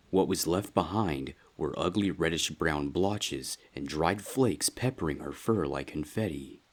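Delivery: ordinary delivery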